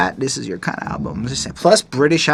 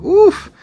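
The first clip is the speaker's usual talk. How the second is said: loud, high "oof"; floored oof; 8 dB louder than usual. Retold or imitated. Reviewer reacting to a cardiac arrest lyric, floored oof. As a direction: react as if hit by the line. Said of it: "I'm like".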